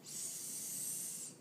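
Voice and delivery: S as one long, unvoiced sss; long sound. Shown as a demonstration. Clinician showing a child the long sound, S held as sss. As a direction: hold S as a sustained, soft sss. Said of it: "The long sound".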